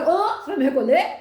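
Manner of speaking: loud, high startled disbelief; retold shock. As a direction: blurt it back in mock shock. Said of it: "Ele me deu um choque". (espanto encenado, alto e agudo)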